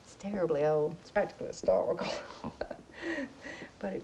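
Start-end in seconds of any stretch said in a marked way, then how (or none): 0.0-2.5 fast whispered joke then breathy laugh; whispered aside. Acted. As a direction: let it slip out as a secret. Confiding aside about old dress.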